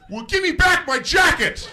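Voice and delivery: high, loud counter-accusation; hammed-up angry retort; mock outraged shout. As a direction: yell back with absurd indignation. Absurd shouted retort to driver.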